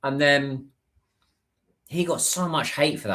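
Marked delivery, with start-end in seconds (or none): none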